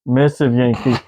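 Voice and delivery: slow, hammed-up Russian accent, broken English; mock Russian accent. Russian soldier mocked over condom sizes, in a hammed-up Russian accent. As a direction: tease the Yankees in a jokey fake Russian accent. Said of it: "I don't know what accent that was".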